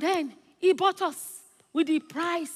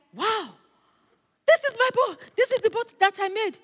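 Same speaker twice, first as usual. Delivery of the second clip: put-on high, excited outburst of a child; excited boy; 9 semitones higher, 6 dB louder than usual. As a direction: cry out in amazed recognition. Acted boy spotting his lost boat.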